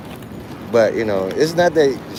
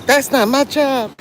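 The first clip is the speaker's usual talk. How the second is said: pitch all the way up; 12 semitones higher than usual. Retold or imitated. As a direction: send the pitch right up, mimicking. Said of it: "their pitch goes all the way".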